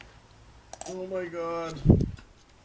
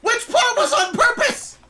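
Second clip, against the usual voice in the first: high, loud sarcastic questions; incredulous shouting; 12 semitones higher, 6 dB louder than usual. Real, not acted. Commentator shouting back at the creator's apology, high and incredulous.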